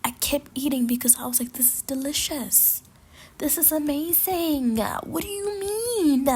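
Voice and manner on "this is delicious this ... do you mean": high, slow swooning over the food; rapturous food praise. Retold or imitated. To her server while stuffed, a swooning high gush. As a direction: gush as if each bite is heaven.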